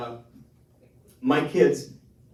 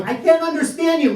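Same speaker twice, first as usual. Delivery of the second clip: exasperated dad; 12 dB louder than usual. Retold or imitated. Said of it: "I'm going".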